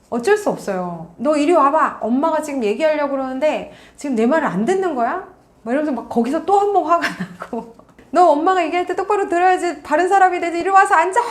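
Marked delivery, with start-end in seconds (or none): 1.2-5.3 put-on mother snapping at a kid who won't listen; angry commanding mom. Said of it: "막 이렇게 명령하시고". 8.1-11.2 much higher and louder, building to a sharp order; angry orders. Retold or imitated. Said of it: "막 이렇게 명령하시고".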